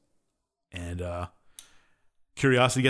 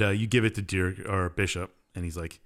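ordinary talk, nothing marked

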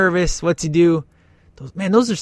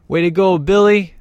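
loud cheer, pitch up; mock cheer; 5 semitones higher, 6 dB louder than usual. Commenter cheering Billy on screen, loud cheer. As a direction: cheer him on loud like a buddy.

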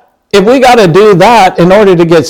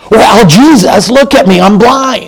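higher, mocking impatience toward Jesus; sarcastic and impatient; 6 semitones higher than usual. Believer sarcastically telling Jesus to see he's blind, mock impatience. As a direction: roll your eyes in your voice, higher pitched. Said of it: "if you're really sarcastic and impatient".

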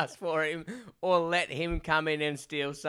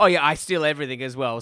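louder, quick hammed-up admission; mock guard confession; 8 dB louder, faster than usual. Mocking the guard to his co-host, a louder, fast cheeky admission. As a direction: toss it off like a cheeky crook. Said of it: "and going".

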